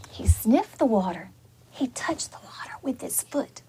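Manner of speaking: hushed storyteller whisper; teacher whispering the tense moment to the children. Acted. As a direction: whisper it to build suspense. Suspense in read-aloud story.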